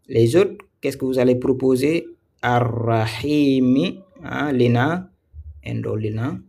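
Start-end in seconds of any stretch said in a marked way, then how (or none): 2.4-4.0 Ar-Rahimi chanted slowly with a long rolled r; slow Quranic reading of Ar-Rahimi; drawn-out recitation. Shown as a demonstration. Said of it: "Très bien, c'est la bonne".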